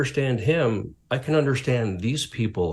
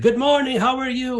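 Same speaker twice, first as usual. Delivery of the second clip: much higher, bright good-morning voice; a tour guide's happy hello, pitched way up; cheery greeting; 13 semitones higher than usual. Retold or imitated. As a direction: greet him like it's the best day ever. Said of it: "he's all happy".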